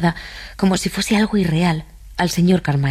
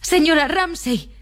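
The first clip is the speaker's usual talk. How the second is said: much higher, drawn-out cry; high plaintive call without much volume; Lily Briscoe crying out; 11 semitones higher, slower than usual. A narrator's reading. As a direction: call the absent woman's name, pitch soaring. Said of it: "gritó en voz alta".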